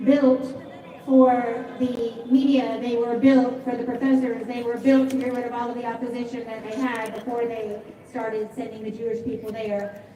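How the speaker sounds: ordinary talk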